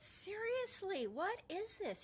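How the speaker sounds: high, slow seriously, exasperated; mock outrage at the absurd fix; exasperated disbelief. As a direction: throw up your hands at him. Exasperated at tech.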